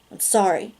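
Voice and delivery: plain reading voice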